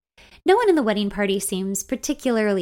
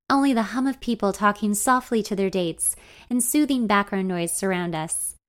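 ordinary delivery, nothing marked

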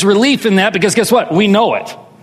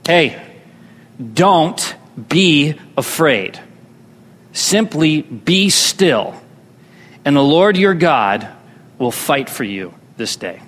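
reassuring; 6 semitones lower, slower than usual. Retold or imitated. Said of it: "God says through Moses".

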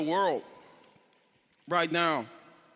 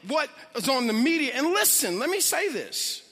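preaching; 6 semitones higher than usual. Acted. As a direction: preach it with rising passion. Preaching builds to full force.

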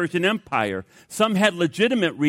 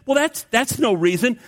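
mock-irritated teacher, pitched much higher; a little irritated; 8 semitones higher than usual. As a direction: play the annoyed teacher, light and exaggerated. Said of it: "a little irritated".

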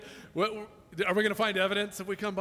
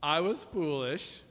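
humble prayer; 4 semitones lower, 3 dB quieter than usual. Shown as a demonstration. Humble admission to God.

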